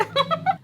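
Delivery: very high-pitched laugh; mocking laugh. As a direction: laugh in his face, high and squeaky. Mocks him for being scared.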